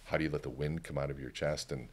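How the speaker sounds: ordinary talk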